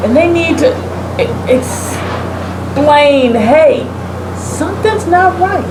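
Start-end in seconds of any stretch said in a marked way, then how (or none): none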